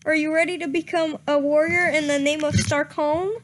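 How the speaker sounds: usual speaking voice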